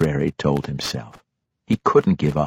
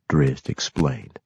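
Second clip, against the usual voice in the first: plain reading voice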